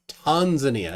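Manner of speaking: Tanzania said TAHN-zuh-nee-uh, broad first A; mix of both. Shown as a demonstration. Host echoing guest's mixed Tanzania, TAHN-zuh-nee-uh. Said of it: "It's a, a mix of both".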